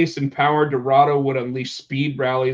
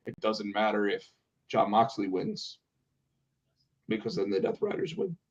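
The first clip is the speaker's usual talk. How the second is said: much softer, flat, lifeless promo; flat Claudio impression; 7 semitones lower, 12 dB quieter than usual. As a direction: mumble it with no energy. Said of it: "he said it just like that".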